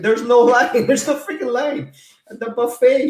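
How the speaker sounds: venting that there was no line; loud incredulous rant; exasperated. Real, not acted. Exasperated about empty buffet.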